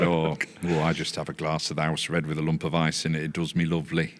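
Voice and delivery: put-on Yorkshire woman; Yorkshire accent. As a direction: imitate an elderly Yorkshire lady. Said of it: "that's a Yorkshire accent".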